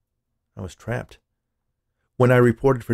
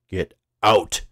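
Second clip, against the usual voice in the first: loud, curt dismissal; acted boss's angry order; angry shout; 5 dB louder than usual. A narrator's reading. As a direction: throw her out with a raised voice. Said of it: "the C E O shouted".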